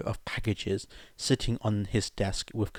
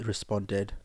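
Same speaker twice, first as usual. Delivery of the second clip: usual speaking voice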